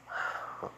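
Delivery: soft, breathy sigh; acted sigh. Storyteller voicing him before he walks over to Shoka, quiet sigh. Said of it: "he sighs".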